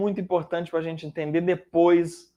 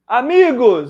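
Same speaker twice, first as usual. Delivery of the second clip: calling out; 5 semitones higher, 9 dB louder than usual. Acted. Jesus calling from the beach.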